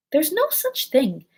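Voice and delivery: high, loud, slow indignation; very angry. A narrator's reading. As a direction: contradict hotly, stretching the words. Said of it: "very angrily".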